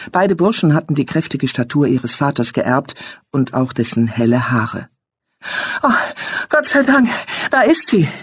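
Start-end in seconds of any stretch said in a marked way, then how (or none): 5.8-8.1 relieved call. A narrator's reading. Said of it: "rief Hinrich".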